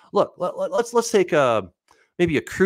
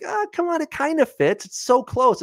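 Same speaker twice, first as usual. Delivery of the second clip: coaxing self-talk; 4 dB louder than usual. Retold or imitated. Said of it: "And I'm like".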